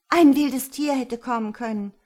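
high, very loud reproach; accusing outburst; indignant protest. A narrator's reading. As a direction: protest hotly at the danger. Ria keeps accusing the elder.

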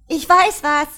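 high, loud shriek. Acted. A tipsy girl shrieks to the room, high and loud. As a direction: blurt it out, shrieking. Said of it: "kreischte ein Mädchen".

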